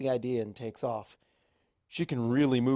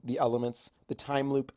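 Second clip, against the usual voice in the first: plain reading voice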